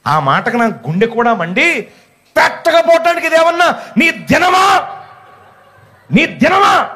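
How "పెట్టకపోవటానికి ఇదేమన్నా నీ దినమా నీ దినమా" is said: high, slow angry shout; angry inner shout. Retold or imitated. Pastor voicing the rage he felt at the old woman, shouted high. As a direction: blurt out the fury he kept inside. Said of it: "అని బయటికి అనలేదండి".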